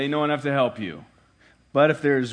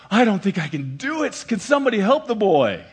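higher, louder mock whine; mock helpless excuse; 5 semitones higher, 5 dB louder than usual. Helpless mock plea. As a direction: PhD student brushing off helping son with addition, mock whine.

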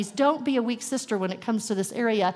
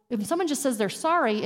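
ordinary talk

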